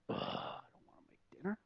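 whispered inner groan; dreading the chore, all whisper; reluctant grumble. Retold or imitated. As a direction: grumble it under your breath, barely voiced. Acting out his reluctant inner grumble.

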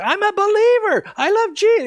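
very high, loud re-enacted shout; retold yelling. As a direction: yell to be heard over a bullhorn. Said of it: "I'm yelling at the guy".

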